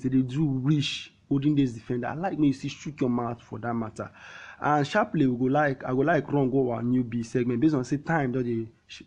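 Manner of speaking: usual speaking voice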